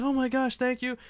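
high, gushing imitation of a fan; grateful fangirl. Fan thanking Brett Favre for signing, gushing mock fangirl. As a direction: pitch it up like a starstruck fan. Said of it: "Ultimate fangirl".

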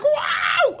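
laughing shout. Real, not acted. Dad reacts laughing to daughter.

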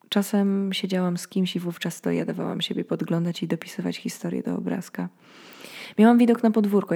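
ordinary delivery

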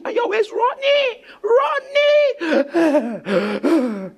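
high, hammed-up cry of someone chased; mock frantic wail. Preacher acting out being chased in dreams, mock wail.

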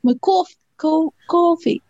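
high, slow stab at New York "coffee"; practising a New York "cawfee"; New York accent. Acted. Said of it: "like, from New York".

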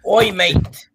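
loud, hammed-up British greeting; mock British accent. Friend clowning British accents, loud mock British. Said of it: "we been clowning British accents".

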